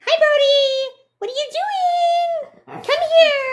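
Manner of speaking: very high, strained head-voice call to a deaf dog; high raccoon-like call; squeaky dog call. Shown as a demonstration. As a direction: pinch the throat and squeak it up high. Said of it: "this is how I've had to talk to him".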